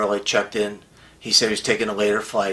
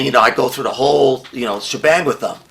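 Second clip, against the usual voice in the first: usual speaking voice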